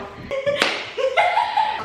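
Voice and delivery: éclat de rire aigu. Real, not acted. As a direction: rire haut et bref. Rire sur la faim. (éclat de rire aigu)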